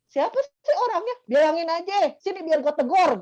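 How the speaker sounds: high-pitched protective outrage; indignant boss. Retold or imitated. Boss offering to confront the gossip, high and indignant.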